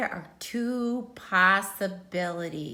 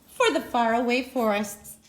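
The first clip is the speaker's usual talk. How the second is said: plain reading voice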